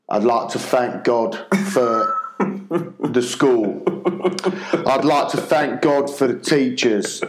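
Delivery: slow, halting improvised prayer; panicked stalling. Retold or imitated. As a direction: grope for each thing to thank God for. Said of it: "he is panicking here".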